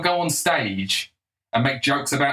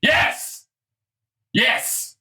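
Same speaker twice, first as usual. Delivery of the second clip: triumphant cheer; 14 semitones higher than usual. Acted. Triumphant outburst.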